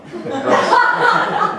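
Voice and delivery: very high, laughing falsetto; squeaky mock answer. Comic answering his own question, squeaky high laughing voice. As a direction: squeak it out as a joke.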